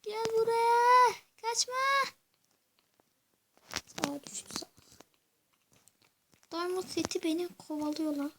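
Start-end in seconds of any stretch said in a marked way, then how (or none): 0.0-1.7 high, slow taunting call; being chased. Real, not acted. 4.1-4.7 hushed whisper. Real, not acted. Hushed aside while hiding.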